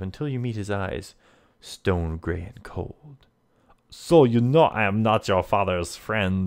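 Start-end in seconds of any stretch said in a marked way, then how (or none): 3.9-6.5 put-on loud villain with a French accent. Lafond confronts the player, French accent. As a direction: play the menacing Frenchman, pushing the volume. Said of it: "he asks in a light French accent".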